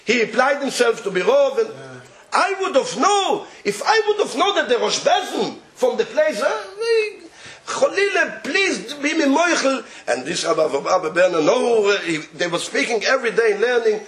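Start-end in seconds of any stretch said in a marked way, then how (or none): 2.3-9.8 high, half-crying and laughing plea; tearful apology. Retold or imitated. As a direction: beg forgiveness through tears.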